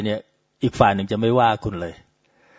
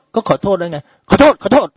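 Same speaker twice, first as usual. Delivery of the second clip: higher, louder impatient brush-off; dismissive; 8 semitones higher, 5 dB louder than usual. Retold or imitated. Nurse impatiently cuts off the mother, higher and louder. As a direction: toss off the sorry to end the call.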